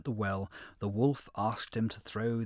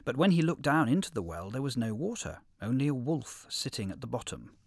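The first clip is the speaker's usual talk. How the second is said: usual speaking voice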